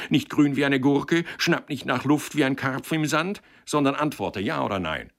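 loud, high, relentless cross-examination; heated rapid-fire reproach; interrogating, indignant matchmaker. Acted. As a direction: drive the accused toward an answer.

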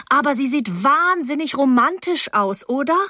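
high, eager enthusiasm; gushing. A narrator's reading. Teenage girl raving about her friend to a boy, gushing.

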